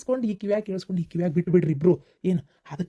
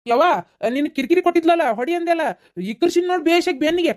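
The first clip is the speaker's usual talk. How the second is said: calling out; 9 semitones higher, 5 dB louder than usual. Acted. Husband calls out to mother.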